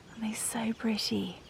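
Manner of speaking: hushed, admiring whisper. Real, not acted. Vlogger whispering about her roses.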